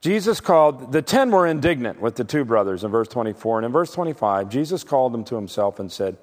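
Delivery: ordinary talk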